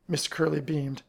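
ordinary delivery

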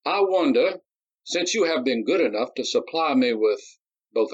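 spring the trap politely; Darius, sly. A narrator's reading. Darius proposing to swap pistols, slyly.

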